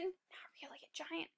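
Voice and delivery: hushed whisper to the class; whispered aside. A narrator's reading. Teacher whispering a correction to the children. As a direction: whisper it as a secret.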